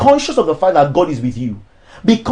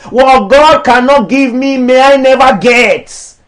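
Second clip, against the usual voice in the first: raised, declamatory sermon voice; preaching; 3 semitones higher, 4 dB louder than usual. Acted. Pastor preaching against charging for ministry, declamatory. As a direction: preach it to the church, each line landing.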